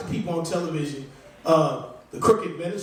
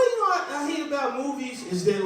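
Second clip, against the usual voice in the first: ordinary delivery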